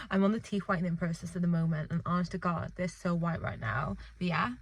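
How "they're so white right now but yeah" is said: normal talking voice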